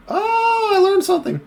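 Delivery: very high-pitched little reaction; squeaky realization. Real, not acted. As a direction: say it way up in falsetto. Mock-amazed at learning a fact.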